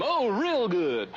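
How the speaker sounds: ordinary talk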